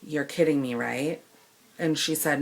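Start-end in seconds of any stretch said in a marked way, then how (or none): 0.0-1.2 low, soft and deadly calm; icy calm disbelief; very calmly. Retold or imitated. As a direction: say it calmly, barely above a murmur. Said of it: "And I said very calmly".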